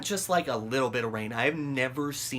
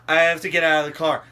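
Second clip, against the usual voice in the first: loud, fast, higher-pitched blurt; urgent outburst; 4 semitones higher, 10 dB louder, faster than usual. Retold or imitated. Fed up with the cab driver, urgent outburst. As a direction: act out a rushed, loud demand. Desperate to leave the taxi.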